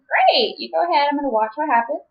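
sarcastic high pitch; high sarcastic quote. Retold or imitated. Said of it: "And I was like".

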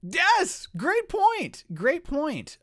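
very high, loud outburst; delighted agreement. Real, not acted. Host to co-host who noticed the error too, excited high cry. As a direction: pounce on it with glee. Host seizes on co-host's point.

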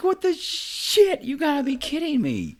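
very high, drawn-out outraged cry; retold disbelief. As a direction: replay the shock, stretching the first words. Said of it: "I like".

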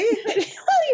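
high, giggly burst of laughter. Real, not acted. Laughing on the greeting.

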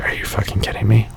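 hushed incredulous whisper under the breath; whispered disbelief. Retold or imitated. Parent muttering to himself about noisy kids, whispered. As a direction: whisper it so the other parents can't hear. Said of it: "And I'm like".

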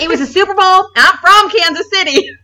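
high-pitched, hammed-up Kansas City accent; thick Kansas City accent. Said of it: "the thickest accent".

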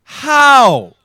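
high, loud, exasperated cry; loud incredulous outburst; exasperated disbelief. Real, not acted. Exasperated at a bad accent.

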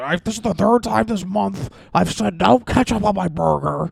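high, loud, whining complaint; whiny angry customer. Retold or imitated. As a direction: whine like a fed-up customer. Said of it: "when he was like".

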